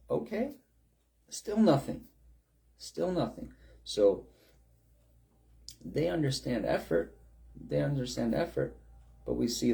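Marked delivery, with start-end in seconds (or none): 0.1-3.5 mocking. Elijah mocking the failed prayers.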